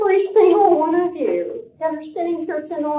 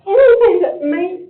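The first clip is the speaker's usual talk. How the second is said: fighting back tears mid-sentence; choked up; 4 semitones higher, 6 dB louder than usual. Real, not acted. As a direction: voice catching with tears, louder.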